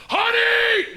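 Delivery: high, loud drawn-out shout; screamed call. Acted. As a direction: yell it across the whole store. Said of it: "I scream out li-, real loud".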